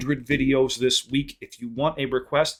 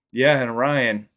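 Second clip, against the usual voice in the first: stretched out, louder, dragging the last words; slow-motion dad quote; 2 semitones higher, 9 dB louder, much slower than usual. Retold or imitated. Dad blurting it at the cousin, slowed-down slow-motion voice. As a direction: replay the blunder in slow motion. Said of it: "it was in slow motion".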